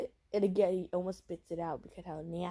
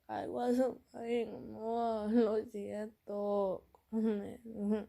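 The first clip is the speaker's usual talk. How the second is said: slow, higher-pitched coaxing; stretched-out, wheedling mock plea; pleading boyfriend; 4 semitones higher, much slower than usual. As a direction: imitate a boyfriend drawing out his apology.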